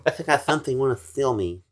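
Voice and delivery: lisping boxer voice, high; lightly voiced lisping tough guy; Mike Tyson impression. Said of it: "That's not what he sounds like".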